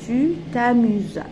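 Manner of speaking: "Tu t'amuses" drawn out slowly and loud; slow dictation. Shown as a demonstration. Teacher repeats the answer for pupils writing it, slow dictation of "Tu t'amuses". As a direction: dictate "Tu t'amuses" slowly, each word held.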